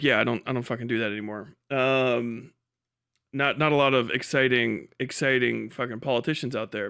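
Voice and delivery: normal talking voice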